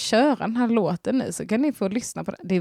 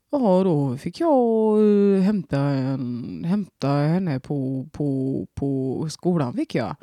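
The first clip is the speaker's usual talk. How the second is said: slow, lightly done Värmland dialect, lower; Värmland accent; 4 semitones lower, much slower than usual. Shown as a demonstration. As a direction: stretch the words in Värmland dialect. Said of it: "Kör värmländska fick jag nu". (långsam värmländska)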